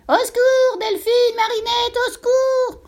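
shrill high panicked scream; pig crying for help. A narrator's reading. Pig screaming to the girls for help, terrified. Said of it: "la voix du cochon qui appelait".

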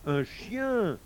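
a touch of appalled whining; mild wail. A narrator's reading. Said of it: "gémit le marabout".

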